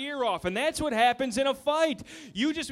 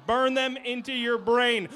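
ordinary talk, nothing marked

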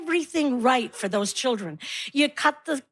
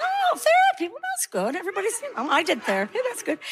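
feigned nonchalance; 6 semitones higher, faster than usual. Acted. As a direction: pretend you're not nosy, high and light. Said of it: "try to pretend like you're not nosy".

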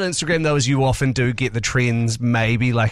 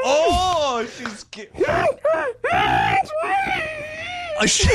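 laughing; much slower than usual. Real, not acted. Laughing reaction to partner's humming.

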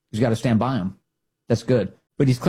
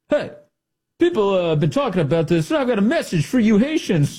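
higher-pitched mock swagger; mock cool announcer; 7 semitones higher than usual. Host imitating the secretary's office video, mock-cool message. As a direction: put on a casual tough-guy address. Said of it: "walking around the office video is like".

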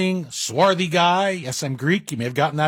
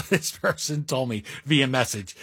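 normal talking voice

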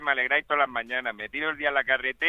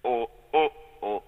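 Darth Vader voice; 4 semitones lower than usual. Pretend. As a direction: sing like a menacing space villain. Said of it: "Voz de Darth Vader".

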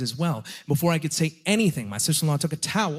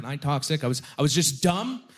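ordinary delivery

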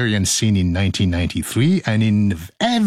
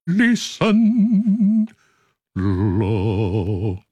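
lion voice; 2 semitones lower, much slower than usual. Acted. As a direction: growl it out like a big lion.

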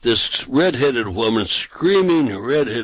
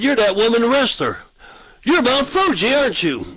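much higher, gleeful recognition; excited; 11 semitones higher than usual. Retold or imitated. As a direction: pitch way up, delighted to spot her. Teasing recognition of the wrestler.

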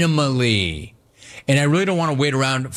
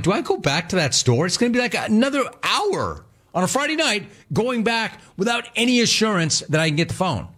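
exasperated; 7 semitones higher than usual. Retold or imitated. Exasperated at phone agent.

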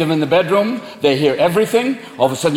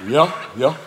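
low, drowsy dog; low, obliging dog voice; dim dog; 6 semitones lower than usual. Acted. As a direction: answer like a simple dog woken up. Dog answers the cat at night.

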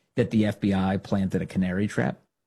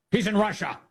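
mock reporter; 10 semitones higher than usual. Host acting out the reporter.

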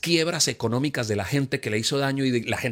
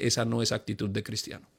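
gentle rebuke; 4 semitones lower, 6 dB quieter than usual. Acted. Voicing the Spirit.

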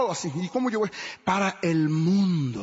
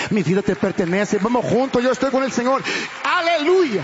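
builds to a loud, high cry of praise; rising fervent shout; 4 semitones higher, 5 dB louder than usual. Real, not acted. A pastor crying out his devotion to God, louder and higher. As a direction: pour it out louder and higher to the Lord. Preaching climax, devoted declaration.

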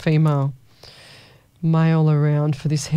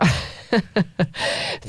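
laughing. Real, not acted. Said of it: "I'm laughing because Melissa Lee".

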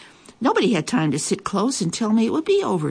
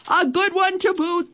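high crew echo, voiced apart; pirate crew; 13 semitones higher than usual. A narrator's reading. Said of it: "the others all agreed".

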